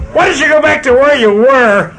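put-on high, loud sassy kid; boy tells his parents to go back, high and loud; rude brat. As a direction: sneer it like a brat.

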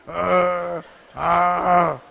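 loud, drawn-out menacing "uh, ah"; menacing grunts. Acted. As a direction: grunt loudly to scare people off. Demonstrates intimidating faces at everybody.

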